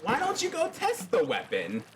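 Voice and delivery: lightly voiced squeaky Sheldon; quick high friendly suggestion; high-pitched old shopkeeper. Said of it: "Sheldon's like".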